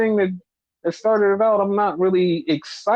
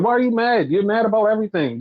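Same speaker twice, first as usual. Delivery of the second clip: normal talking voice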